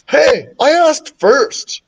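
put-on high, drawn-out kid's complaint; complaining. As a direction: protest like a child cut in line. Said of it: "Michael complained".

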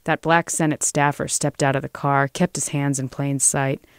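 plain reading voice

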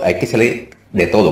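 usual speaking voice

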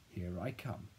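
usual speaking voice

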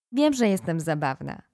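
usual speaking voice

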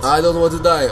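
loud, high mock panic; panicked. Real, not acted. Player under attack crying out to his friend, loud and high. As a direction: yelp it out in a half-joking fright.

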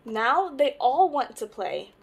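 ordinary delivery

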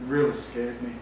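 unconvincing scared said without emotion; deadpan fake fear. Shown as a demonstration. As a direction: claim to be scared while sounding bored. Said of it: "if I speak like that".

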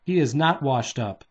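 plain reading voice

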